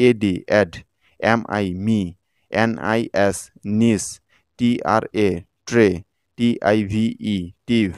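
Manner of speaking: name the letters, pause, sound the chunk; segmented spelling demo with gaps between syllables; measured, teacherly chunk-by-chunk breakdown. Shown as a demonstration. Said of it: "আগের মতো করে সিলেবলে ভেঙে".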